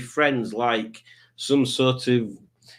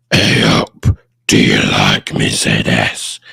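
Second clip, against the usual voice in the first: very deep, loud growling voice; Carl Davis impression; 9 dB louder, slower than usual. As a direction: drop it way down into a comic monster growl. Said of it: "that's how Carl talks".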